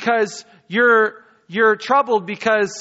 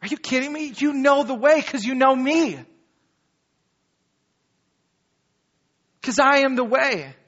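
higher, amazed disbelief, then landing it; who, me? you know me; incredulous; 6 semitones higher than usual. Retold or imitated. Pastor voicing Jesus answering Thomas, incredulous and higher. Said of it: "And Jesus is like".

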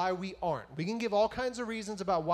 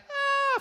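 high, loud mock "ah" of alarm; cartoonish high-pitched scream; 5 dB louder than usual. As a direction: let out a loud pretend scream. Jonah swallowed by whale, mimicked scream.

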